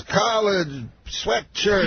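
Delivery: slow, high imitation in isolated words; Bill Cosby. Star explaining his clothes to his opener, slow high imitation. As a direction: stretch each word out, pitched high. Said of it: "He talks, like, just in words".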